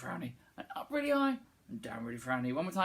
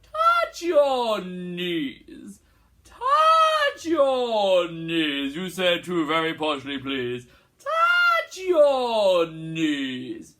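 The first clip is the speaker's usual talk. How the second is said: slow, loud, grand posh voice; 2 semitones higher, 9 dB louder, much slower than usual. Shown as a demonstration. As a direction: stretch it out terribly posh.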